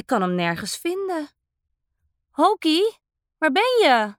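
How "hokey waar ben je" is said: put-on child calling for his friend, a bit louder and higher; boy searching his room calls out for Hokey. A narrator's reading. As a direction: call across the room for the ghost. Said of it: "roept Dadu".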